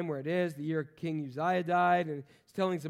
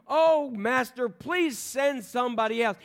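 loud, slow, drawn-out begging; mock pleading; 6 semitones higher, 6 dB louder, slower than usual. Moses begging God to send someone else, loud and slow. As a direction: whine it like a cornered Moses. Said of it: "Moses just finally gets this point and said".

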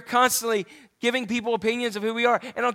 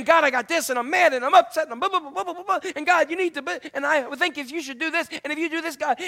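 whiny demanding prayer; 7 semitones higher than usual. Retold or imitated. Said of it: "going to prayer and saying".

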